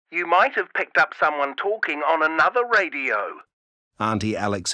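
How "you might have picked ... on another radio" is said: mum through a crackly walkie-talkie; easy denial over radio static; scratchy radio voice. A narrator's reading. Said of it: "the scratchy voice".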